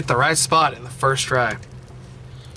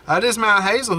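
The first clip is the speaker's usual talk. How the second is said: usual speaking voice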